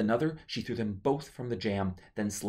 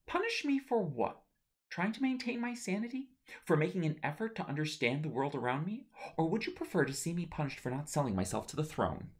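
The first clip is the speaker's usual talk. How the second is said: high, pressing demand; fierce demand; 9 semitones higher than usual. Acted. Daughter demanding of her mother, fiercely higher. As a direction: throw each question back higher. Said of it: "fiercely demanded".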